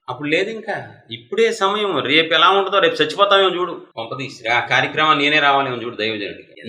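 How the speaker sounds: ordinary talk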